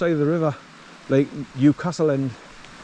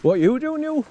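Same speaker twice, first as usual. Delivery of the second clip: puzzled at the dog; 9 semitones higher than usual. Real, not acted. Dog acting up.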